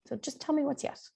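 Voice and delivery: high, soft pleading ask. Retold or imitated. Repeats request in other voice.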